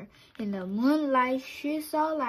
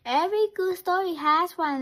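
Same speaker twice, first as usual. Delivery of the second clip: fast, high, louder insistence; insistent child; 7 semitones higher, 6 dB louder, faster than usual. Acted. Chick insisting to Papa that every story has one. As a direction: insist eagerly.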